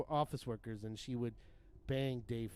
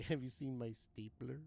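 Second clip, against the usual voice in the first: stapler guy impression; 5 dB quieter than usual. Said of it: "the stapler guy".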